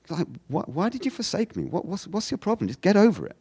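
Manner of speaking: high, incredulous mock protest; exasperated challenge. Jesus telling an obsessive God to get over it, exasperated challenge. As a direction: question them in a high voice, then dismiss them. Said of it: "perhaps just with a change of intonation".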